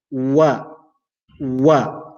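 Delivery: wa started with round lips, then opened; Arabic waw. Shown as a demonstration. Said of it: "I start ... while my lips are round".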